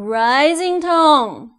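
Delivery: "Rising tone" drawn out, pitch gliding up. Shown as a demonstration. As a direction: stretch "Rising tone" slowly with an upward sweep. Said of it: "second tone is a rising tone".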